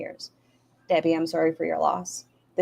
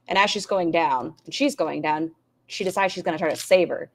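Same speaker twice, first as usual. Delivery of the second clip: usual speaking voice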